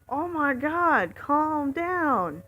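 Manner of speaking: high, loud retold outburst; exasperated yell. Driver snaps back at yelling passenger.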